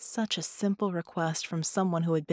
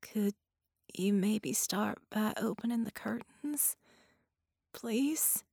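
slow, higher, soft female patient voice; weak, pleading patient; 4 semitones higher, 5 dB quieter, slower than usual. A narrator's reading. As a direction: ask softly and haltingly from a sickbed.

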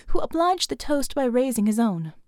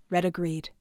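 ordinary delivery, nothing marked

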